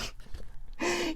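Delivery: short high burst of laughter. Real, not acted. Reading the funny card to viewers, laughing. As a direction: giggle at the card.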